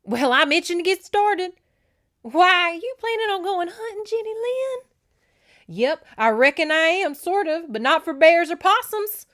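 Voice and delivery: high, loud character voices; hammed-up eager exchange, loud and high; playful folksy banter. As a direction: pitch it way up for teasing banter. Acting the two women's banter.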